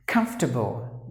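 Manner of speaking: "Comfortable" as KUMF-ter-bul, three beats; comfortable three syllables. Shown as a demonstration. Teacher shows how to say "Comfortable". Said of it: "That's how we gotta pronounce the word".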